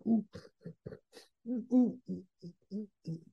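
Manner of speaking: small, high "ooh" sounds, softening; stifled cry. Acted. Little boy squishing down cry and anger, stifled. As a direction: imitate a child squashing down his sobs. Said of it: "this cry and this anger".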